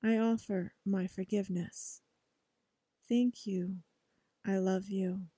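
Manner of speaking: quiet, measured mantra recitation; soft affirmation. Shown as a demonstration. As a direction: say each phrase softly like a prayer. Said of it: "you are saying".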